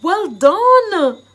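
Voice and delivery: loud, high cheering; excited praise. Shown as a demonstration. Teacher acting out congratulating a friend, loud and excited. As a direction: shout out hearty congratulations. Acted praise in phrase demonstration.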